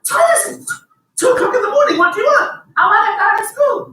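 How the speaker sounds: mock-furious dad at two in the morning; exasperated shout. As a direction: yell at the kid who woke you. Father scolding son at 2am.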